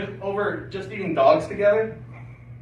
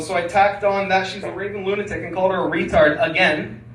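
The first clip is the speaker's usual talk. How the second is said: usual speaking voice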